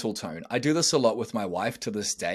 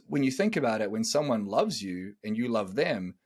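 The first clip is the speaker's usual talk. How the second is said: ordinary delivery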